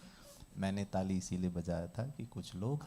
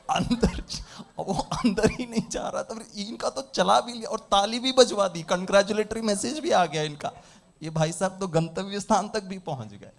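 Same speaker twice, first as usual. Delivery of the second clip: high, loud comic complaint; mock incredulous participant; 9 semitones higher, 10 dB louder than usual. Participant complaining that others already finished driving, mock exasperation. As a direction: build into an exasperated, envious protest. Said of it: "और वो उन लोगों को सोच रहे हैं".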